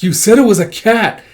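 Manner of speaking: high, loud protest; heated retort. Acted. Character answering an accusation in a quarrel, heated retort. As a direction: throw the blame straight back.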